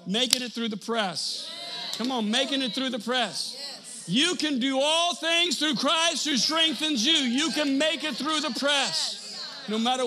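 high, loud, slow preaching; preaching at full force. Acted. Preacher urging the congregation, at full force. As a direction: drive it home like a preacher.

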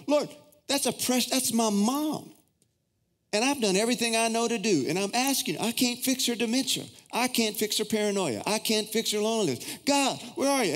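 high-pitched, exasperated pleading with God; having a moment. Retold or imitated. Son re-enacts telling God he can't fix Mom, frustrated outburst. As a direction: plead your case at the top of your range, at wits' end. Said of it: "I was having a moment".

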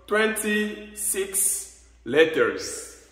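slow English "twenty-six letters"; slow careful teacher model; English demo. Shown as a demonstration. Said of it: "Donc, il y a vingt-six lettres".

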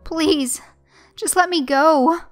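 high, loud desperate plea; begging. A narrator's reading. Victim pleading with the monster, begging. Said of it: "I begged".

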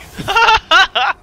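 high, loud burst of laughter; shrieking laugh. Real, not acted. Gamer laughing as his hero dies, shrieking. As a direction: crack up at the sudden death.

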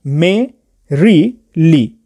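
मे, री, ली each said apart with a pause between; syllable by syllable. Shown as a demonstration. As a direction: break मैरिली into मे - री - ली, stress on मे. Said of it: "तीन syllable में बटा है".

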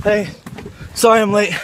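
usual speaking voice

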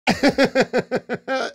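high, quick-pulsed chuckle; real laugh. Real, not acted. Streamer amused by his own cuddling confession, high laugh. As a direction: burst into a short high laugh.

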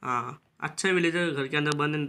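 ordinary talk, nothing marked

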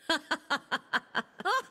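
long staccato laugh; long character laugh. Acted.

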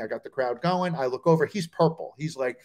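usual speaking voice

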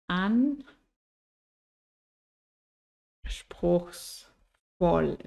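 "Anspruchsvoll" very slowly, in three separated parts; syllable by syllable. Shown as a demonstration. Teacher spelling out "Anspruchsvoll" for learners, syllable by syllable. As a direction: draw out "Anspruchsvoll" with long pauses between syllables.

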